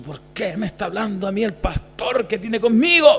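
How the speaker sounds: a lightly voiced offended churchgoer asking why; mock the listener who feels singled out. Pastor imitating a listener who feels singled out, mildly indignant. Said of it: "y dices tú".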